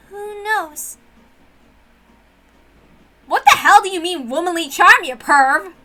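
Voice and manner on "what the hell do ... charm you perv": loud, offended snap at a perv; embarrassed angry retort; indignant scolding. Acted. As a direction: call him out, outraged. Girl scolds boy's crude remark.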